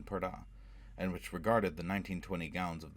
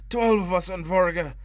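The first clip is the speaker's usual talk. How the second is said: a much higher, louder scream of panic; desperate scream; 12 semitones higher, 12 dB louder than usual. A narrator's reading. Drowning man screaming the answer in terror. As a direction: scream it out to save yourself. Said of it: "he screamed".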